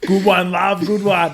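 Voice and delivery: loud, laughing sarcasm; sarcastic praise. Retold or imitated. Boyfriend sarcastically praising girlfriend, loud laughing. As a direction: praise her mockingly, laughing.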